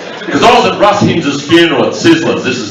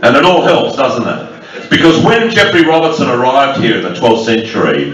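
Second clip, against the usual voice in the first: normal talking voice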